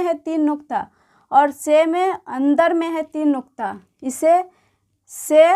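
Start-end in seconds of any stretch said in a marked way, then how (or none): 5.1-5.5 "से" said loud and stressed, a crisp s sound with a long e; letter name demo. Shown as a demonstration. Teacher calling out the letter name to students, loudly. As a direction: punch out "से" for the class. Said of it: "बोलते हैं".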